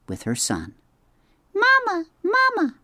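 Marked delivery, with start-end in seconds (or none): 1.5-2.7 very high, tiny chick voice; peeping gosling. A narrator's reading. Brightbill peeps to his robot mother, tiny and hungry. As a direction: peep it like a hungry baby bird.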